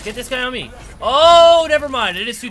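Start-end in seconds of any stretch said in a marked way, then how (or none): 1.0-2.2 sudden loud yelp, then quick retreat; surprised outburst. Real, not acted. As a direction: jolt of surprise, then take it back. Sudden surprise mid-fight.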